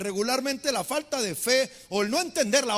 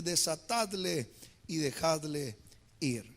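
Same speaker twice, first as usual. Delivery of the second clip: lower, soft and slow; soft command; 8 semitones lower, 7 dB quieter, slower than usual. Retold or imitated. Jesus telling the crowd to free Lazarus, softly. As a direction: release him gently.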